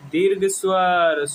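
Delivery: normal talking voice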